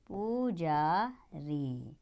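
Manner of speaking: very slow, soft reading. Shown as a demonstration. Teacher to children repeating Pujari for writing, very slow and soft. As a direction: dictate Pujari very slowly and softly.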